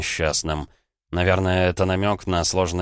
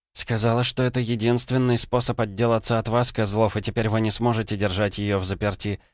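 plain reading voice

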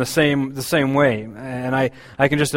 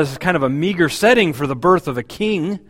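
ordinary talk

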